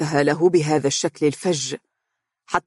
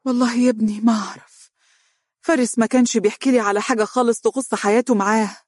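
higher, quick, dazed reply; absent-minded; 6 semitones higher, faster than usual. A narrator's reading. The mother admits her son told her nothing, absent-minded. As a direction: answer as if lost in thought, pitch lifted. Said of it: "وهي تقول بشرود".

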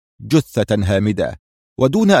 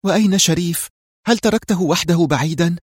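higher-pitched, anxious questioning; tense; 7 semitones higher than usual. A narrator's reading. She asks after their missing companion, tense. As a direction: ask tensely in a raised female character voice. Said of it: "سألته في توتر".

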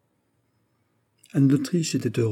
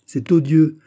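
loud, higher burst of outrage; furious; 7 semitones higher, 9 dB louder than usual. A narrator's reading. Prince venting to his favourite, furious outburst. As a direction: cry out in indignant fury. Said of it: "il donna un libre cours à sa fureur".

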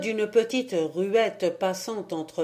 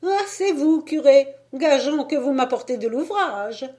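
put-on much higher, louder old lady voice; teasing the priest she knows well; old lady greeting; 7 semitones higher, 6 dB louder than usual. A narrator's reading. (vieille demoiselle taquine, voix aiguë)

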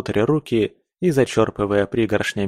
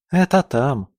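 put-on little girl voice; 4 semitones higher than usual. A narrator's reading. Girl points the way.

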